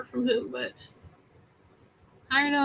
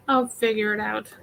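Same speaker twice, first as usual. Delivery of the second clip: usual speaking voice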